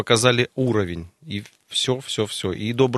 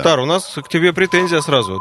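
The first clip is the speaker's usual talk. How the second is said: mock accusing; 7 semitones higher, 5 dB louder than usual. Mock complaint to caller.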